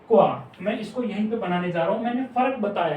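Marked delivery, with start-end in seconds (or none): 0.1-0.5 क्वा said as one gliding syllable, kwa, firm and falling; compound consonant kwa. Shown as a demonstration. Teacher answering her own question to the class, firmly saying क्वा. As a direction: blend k and w into क्वा, short and falling. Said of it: "इसको हम pronounce करेंगे".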